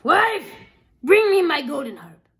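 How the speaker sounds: loud, high call on "Wife"; ogre calling out. Acted. Ogre calls his wife to fetch the harp, loud. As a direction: summon the wife across the house. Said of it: "the ogre called out".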